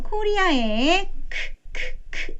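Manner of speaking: 크 slow then breathed unvoiced; K sound demo. Shown as a demonstration. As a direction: whisper a clipped 크 sound. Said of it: "이렇게".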